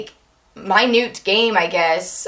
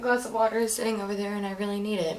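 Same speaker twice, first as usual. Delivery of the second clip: low, very soft and worn out; real tired voice, hushed and low; fried-out voice; 3 semitones lower, 11 dB quieter than usual. Real, not acted. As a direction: talk quietly on a worn-out voice. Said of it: "My voice is so fried out".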